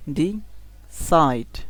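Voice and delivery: Decide slowly, with side punched; drawn-out decide, emphatic last syllable; stress on second syllable. Shown as a demonstration. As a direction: say ডি, pause, then hit side in decide. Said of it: "এটা একটু জোরে বলতে হবে".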